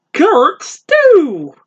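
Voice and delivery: high, slow rabbit voice. Acted. Rabbit answering the lion, high and slow. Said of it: "The little rabbit said".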